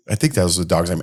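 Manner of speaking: normal talking voice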